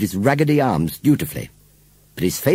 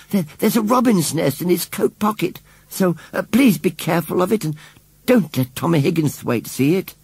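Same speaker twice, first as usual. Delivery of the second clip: higher-pitched, hesitant pleading; anxious little girl; 9 semitones higher than usual. A narrator's reading. Susan rushed up to warn Missus Kibbins, anxious. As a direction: plead urgently, stumbling at the start.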